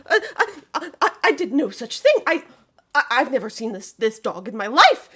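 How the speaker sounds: high, loud stammer with a nervous laugh; frightened stammering denial, pitched high and loud; terrified stammering. Acted. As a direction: babble a panicked denial. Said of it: "he stutters for a long time".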